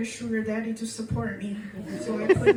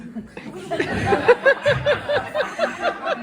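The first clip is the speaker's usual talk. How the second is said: high loud cackle; laughing high and loud; loud shrieking laugh; 13 semitones higher, 9 dB louder than usual. Real, not acted. Laughs at blowjob visa line.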